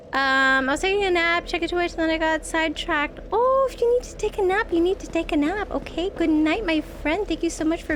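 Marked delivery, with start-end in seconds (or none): none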